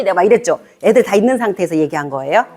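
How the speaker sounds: normal talking voice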